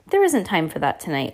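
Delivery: plain reading voice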